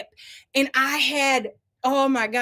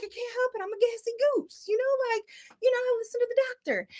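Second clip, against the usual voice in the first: high playful voice; 10 semitones higher, 4 dB quieter, faster than usual. Retold or imitated. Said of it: "I was like".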